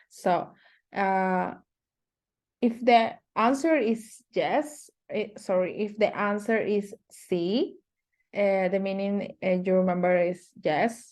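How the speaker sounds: ordinary talk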